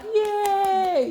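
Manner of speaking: high, loud, drawn-out pretend cheer; mock audience cheer. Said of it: "I would do, like, the audience clap".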